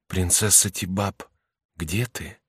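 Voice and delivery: очень тихо, смиренно, дрогнувшим голосом; робкий тихий зов с дрожью; quiet trembling call. A narrator's reading. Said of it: "воскликнул очень тихо, смиренно, дрогнувшим голосом". (тихо и смиренно, голос дрожит)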